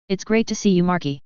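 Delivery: usual speaking voice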